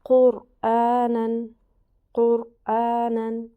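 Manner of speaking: قرآنن drawn out very slowly, with a heavy ر; slow Quran recitation. Shown as a demonstration. Teacher giving the pesh example, slow recitation. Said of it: "را ساکن سے پہلے پیش ہے".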